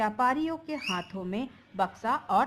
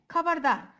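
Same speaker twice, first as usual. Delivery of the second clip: loud, higher warning bark; bandit's threat; 7 semitones higher, 6 dB louder than usual. Acted. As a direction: stop them cold.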